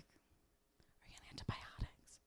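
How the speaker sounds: hushed unvoiced whisper. Friend whispering a nosy question, hushed. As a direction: ask it in secret.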